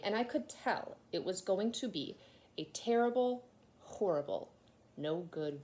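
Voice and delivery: lively storybook reading voice; reading to children. A narrator's reading. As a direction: tell it like a bedtime story. Reads children's story aloud.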